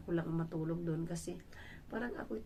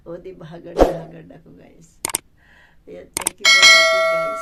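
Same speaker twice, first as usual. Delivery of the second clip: loud exclaim; 22 dB louder than usual. Real, not acted. Vlog outro, loud sign-off.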